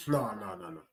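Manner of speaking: breathless whisper. Real, not acted. Fan whispering in dread at the screen. Reacting to chance.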